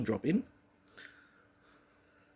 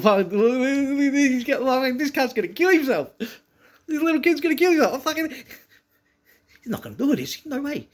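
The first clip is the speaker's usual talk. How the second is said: high, strained crowd chatter; alarmed onlookers; 10 semitones higher, 5 dB louder than usual. Retold or imitated. Big kids watching a reckless jump, high alarmed disbelief. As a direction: imitate kids yelling in disbelief, pitched way up.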